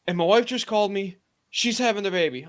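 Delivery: mildly frantic imitation of an expectant dad; retold panic. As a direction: blurt out the news like a rattled coworker. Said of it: "I can hear the panic in his voice".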